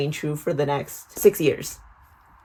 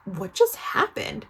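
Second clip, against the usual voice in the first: shocked; 3 dB quieter than usual. Retold or imitated. Retold shock at boy running off.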